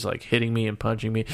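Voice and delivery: normal talking voice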